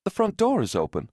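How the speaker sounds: high-pitched, puzzled report; puzzled announcement. A narrator's reading. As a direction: voice lifted high at a puzzling find. Woman first tells husband about door.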